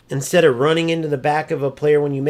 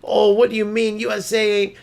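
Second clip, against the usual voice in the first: higher-pitched mimicry of a rival; mock dumb protest; 6 semitones higher than usual. Streamer acting out rival looking dumb, higher mocking voice. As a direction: imitate a foolish objection, voice pitched up. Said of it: "look even more dumb when he's going".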